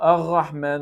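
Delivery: 'Ar-Rahman' with a throaty R; French R. Shown as a demonstration. Teacher recapping mistakes, French throat R. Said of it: "the French one".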